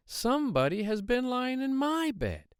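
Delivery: middle-sized bear, pitched very high; high mother-bear complaint, not shouted; Mama Bear. A narrator's reading. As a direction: raise the pitch, keep the volume moderate. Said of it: "said the Mama Bear in her middle-sized voice".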